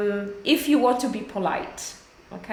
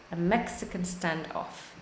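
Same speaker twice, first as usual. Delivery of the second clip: softer repeat; 4 semitones lower, 9 dB quieter than usual. Shown as a demonstration.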